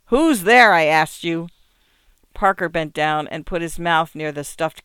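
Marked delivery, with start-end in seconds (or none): 0.1-1.5 raised, louder demanding call; sharply insistent challenge through a door; peremptory. A narrator's reading. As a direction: demand an answer, pitched up on the question. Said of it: "the voice said peremptorily".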